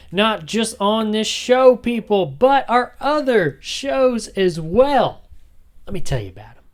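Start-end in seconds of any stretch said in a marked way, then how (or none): 0.1-5.3 slow, higher, louder announcement; a touch of a hype announcer; emphatic plug. Acted. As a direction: stretch it out for listeners.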